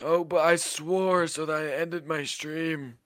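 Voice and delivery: slowed mock streamer voice; Chris Ventura voice. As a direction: drag it out as the pretend Chris. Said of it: "how are you, Chris?".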